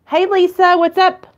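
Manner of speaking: high, loud call to Lisa. Real, not acted. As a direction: sing out a greeting across the room. Calling out to Lisa.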